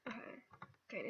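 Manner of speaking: ordinary delivery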